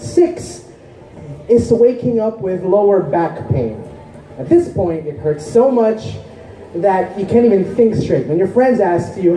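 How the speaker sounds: measured, explanatory poetry recital; spoken-word poem. Acted. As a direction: recite it like a stage poem, stressing key words.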